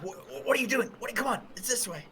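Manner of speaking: high, hurried stammering protest; flustered. Acted. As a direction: hustle them the other way fast. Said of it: "I'm like".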